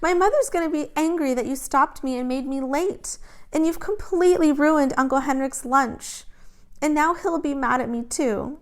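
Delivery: high, tearful complaint; upset, tearful girl; crying. A narrator's reading. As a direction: whine through tears. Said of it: "she began to cry".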